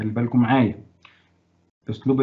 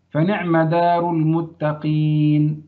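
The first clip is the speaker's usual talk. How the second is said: slow example read; 3 semitones higher, much slower than usual. Shown as a demonstration. Teacher reading the example aloud slowly to students. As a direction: read فنعم دار المتقين very slowly, word by word. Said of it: "مثل إيه؟".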